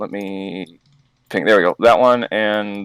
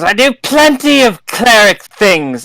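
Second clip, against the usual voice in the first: indignant cleric; 12 semitones higher, 8 dB louder than usual. Acted. Player mock-indignant in character.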